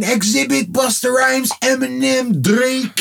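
high-pitched playful exhortation; mock pleading. Fan urging [name] to release an album, high mock plea. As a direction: plead up high, as if calling to him.

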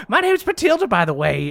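high-pitched witch introducing herself; breezy witch. Acted. Witch introduces herself to Fia, breezy. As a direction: toss out the name in a high character voice. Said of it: "she goes like".

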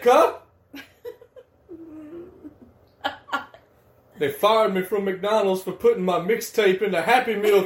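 loud, high mock hustler pitching his mixtape to friends; mock rapper voice. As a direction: play a broke mixtape rapper begging for listeners, laughing through it. Friends riffing a wannabe rapper voice.

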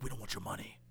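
very low, hushed tough guy; mob-friend growl. Acted. Old mob friends refuse his money, low hushed tough talk. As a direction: drop low and soft, mock mobster loyalty.

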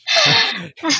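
high, loud burst of laughter. Real, not acted. Host laughing at offering to call police together, high loud laugh. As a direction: crack up in a short peal.

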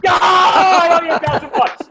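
loud, high laughing yell; excited laughing shout. Real, not acted. Correct guess, laughing shout.

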